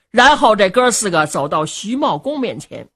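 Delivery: ordinary delivery, nothing marked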